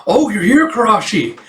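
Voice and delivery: high, bright greeting as the cheerful senior; Asagao's voice. Acted. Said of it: "So you have a".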